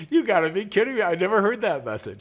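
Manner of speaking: loud, high disbelief; can't believe what you're hearing; incredulous. Retold or imitated. Mock outrage at phone message.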